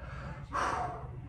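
long calming exhale; deep breath. Retold or imitated. Settling into the Uber after the panic, long steadying sigh. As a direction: let out a slow, heavy breath. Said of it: "I'm taking my deep breaths".

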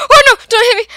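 high, loud panicked cry; scared plea. Real, not acted. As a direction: beg not to be hit, terrified. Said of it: "You look so scared then".

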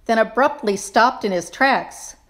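usual speaking voice

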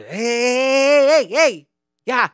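loud, high yelling dad in Spanish; angry Mexican dad. Retold or imitated. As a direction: shout down the dancing kid after work. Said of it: "my dad would just be".

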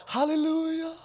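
high, squeaky mock-gushing praise; sing-song sarcastic delight; mock overjoyed. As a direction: gush like a fake super-saint. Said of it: "I'm like".

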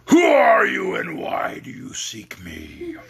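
booming beast voice; beast roar. Acted. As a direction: demand it like a towering beast. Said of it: "in a voice that was one great roar".

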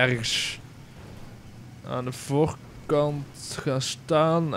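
ordinary talk

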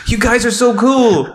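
high, gushing fanboy voice. Retold or imitated. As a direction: gush like an eager fanboy. Mocking a fawning weeb.

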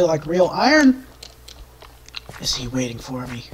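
ordinary talk, nothing marked

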